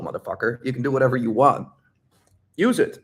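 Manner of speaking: normal talking voice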